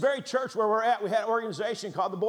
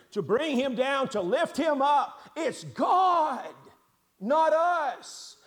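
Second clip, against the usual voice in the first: higher and louder, building to a ringing cry; rising preacher's cry; 7 semitones higher, 5 dB louder, slower than usual. Real, not acted. Preacher telling the congregation it's God, not us, in a rising ringing cry. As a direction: lift each phrase until it rings out. Preacher's climax on God's work.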